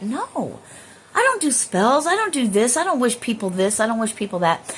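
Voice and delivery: high, loud self-defense easing down as it goes; indignant protest. Acted. Answering a karma accusation, acted indignant protest. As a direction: protest your innocence, starting high and insistent.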